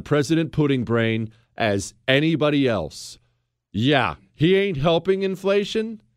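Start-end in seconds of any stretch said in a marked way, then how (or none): none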